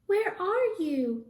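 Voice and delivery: high, thin squeak; a lost baby's tiny squeak. Acted. Lost baby bat calls for her mother, high squeak. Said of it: "Stella Luna squeaked".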